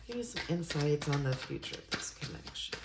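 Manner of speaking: a hushed, reverent ask; whispered question. Real, not acted. Reader to her tarot deck, asking in a whisper.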